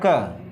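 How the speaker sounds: "क" as a lone "kuh"; 'क' letter sound. Shown as a demonstration. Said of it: "एक ही उच्चारण होता है". ('क' की ध्वनि का उच्चारण)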